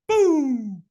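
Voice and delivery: high, loud vocal boom; shouted take-off sound effect; explosive boom. Retold or imitated. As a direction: launch it like a burst, drawn out. Imitated burst of taking off.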